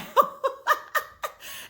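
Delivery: real laugh bursting out; tickled laughter at the comeback. Real, not acted. Laughing at Montrose's line.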